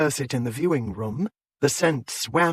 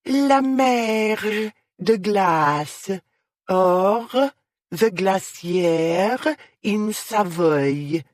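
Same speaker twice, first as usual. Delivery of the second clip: put-on very slow, higher French accent with thick R's; grand, slow stage-French announcement; overdone French accent; 6 semitones higher, much slower than usual. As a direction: stretch it out like a head-cold Frenchwoman. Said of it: "in an overdone French accent".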